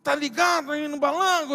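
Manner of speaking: mock street-slang tough guy; punchy street-talk caricature. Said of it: "parecia aqueles manos assim do gueto".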